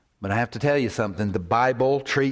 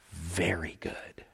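slow, low near-whisper; hushed approval; 6 semitones lower, 10 dB quieter, slower than usual. Acted. God judging his work on the sixth day, hushed approval. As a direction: breathe it out slowly, low and very soft.

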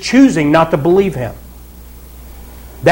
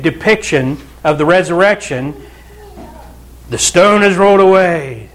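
ordinary talk

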